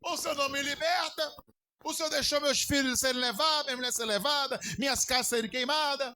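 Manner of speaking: high-pitched, pleading reproach to God; acted tearful complaint. Preacher voicing a weeper blaming God for his losses, acted complaint. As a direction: protest upward like a mock lament. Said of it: "o choro é como se mandasse uma mensagem pra Deus".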